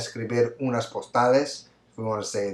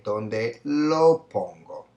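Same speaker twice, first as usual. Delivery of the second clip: slow answer; 4 dB louder, much slower than usual. Shown as a demonstration. Teacher revealing the answer slowly to students. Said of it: "we would say".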